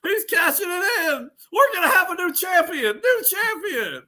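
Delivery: screaming high-pitched ringside call; hammed-up, frantic championship call; mock wrestling announcer. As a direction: shout it like a shock title change.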